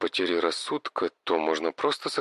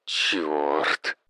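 cursing; 6 semitones lower than usual. Acted. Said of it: "Мужчина выругался".

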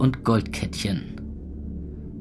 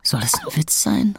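high, unvoiced incredulous; disbelief whisper; 8 semitones higher than usual. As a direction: gasp out the question. Stunned disbelief.

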